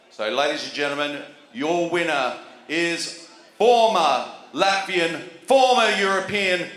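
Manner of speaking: big ring announcer build to the name; boxing-style champion intro, rising to a roar; booming pumped announcer. Real, not acted.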